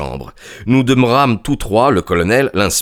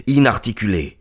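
ordinary delivery

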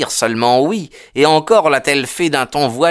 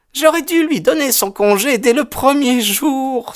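high, rueful woman's voice; sighed regret; 14 semitones higher than usual. A narrator's reading. Landlady admitting she should have evicted him, sighing. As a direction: sigh it out with regret, pitched high. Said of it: "soupira-t-elle".

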